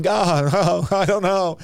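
high, whiny hammed-up panic; mock freaking out. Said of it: "they're freaking out".

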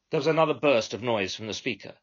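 ordinary delivery

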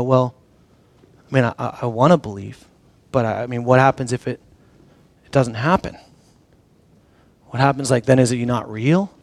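soft, low, hesitant doubt; timid, halting uncertainty; shrinking back. Acted. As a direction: stammer it quietly, backing away from the answer. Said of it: "We kinda shrink back".

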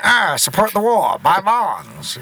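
old-timey wartime announcer, lightly voiced; mock a 1940s war-bond pitch. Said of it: "like, a gangster in the forties".

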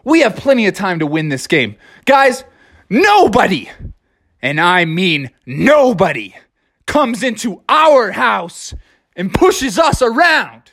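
Froggy rallying his team in a huddle; Froggy pep talk. Acted. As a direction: fire up the team. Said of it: "He said".

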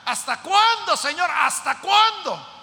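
high, loud anguished plea, slowing at the end; pleading shout to heaven; desperate cry. Acted. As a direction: call out to God in despair. Voicing a desperate prayer to God.

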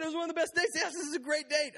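high squeaky backpedaling; his own defensive squeak. Retold or imitated. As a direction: squeak out a nervous correction. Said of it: "I said, I said".

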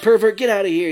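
loud, high mock shout at a stranger; playful retold yell. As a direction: bark it like catching a creep. Anthony Anderson yelling at stranger.

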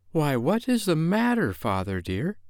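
high, questioning wife voice; lightly voiced anxious wife; concerned wife. A narrator's reading. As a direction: ask with a high, lilting concern. Wife asks agitated husband what's wrong.

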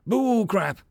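high-pitched mild scream. A narrator's reading. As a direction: scream it at the recruit's ear. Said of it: "Arvin screamed at his ear".

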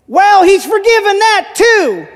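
high, loud, drawn-out gleeful comeback; Delmar. Retold or imitated. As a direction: proclaim it like a jubilant new convert. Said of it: "I think it was Delmar".

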